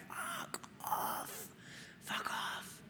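breathless whispered fuck off; aghast hushed fuck off; whispered disbelief. Retold or imitated. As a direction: whisper it in mock horror. Reacting to no alcohol.

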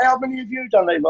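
high-pitched, wide-eyed disbelief; mock amazed friend. Mate asking Levi how many pills, mock amazed. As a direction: put on the mates' shock at the pill count.

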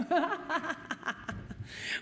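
short self-conscious laugh at fumbling the word; embarrassed laugh. Real, not acted. Stumbling on a word at the meeting, sheepish laugh. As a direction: laugh it off lightly. Tripping over the word united.

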